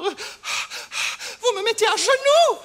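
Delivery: slow, high, overwrought wail; melodramatic lament. Acted. Landlady ruined by the doubled rent, melodramatic lament.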